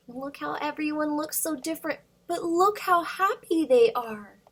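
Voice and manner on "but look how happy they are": ordinary delivery, nothing marked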